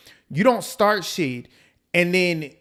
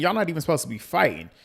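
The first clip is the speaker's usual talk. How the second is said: ordinary talk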